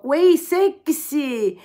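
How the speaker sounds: mock sexy greeting. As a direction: hams up a cheeky catcall from the chat. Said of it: "Sono tipo". (saluto sexy esagerato e lento)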